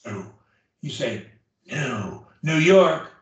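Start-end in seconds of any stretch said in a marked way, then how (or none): 0.1-0.3 faint whispered NEW. Shown as a demonstration. Teacher corrects the student's practice, whispering. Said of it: "whispered new". 1.7-2.3 whispered NEW leading into the voiced words. Shown as a demonstration. Teacher shows student how to connect it, whispering. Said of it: "whispered new".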